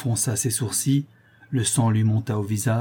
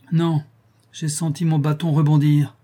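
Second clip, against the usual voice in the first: heated retort; 5 semitones higher, 4 dB louder than usual. A narrator's reading. Heated retort after striking his brother.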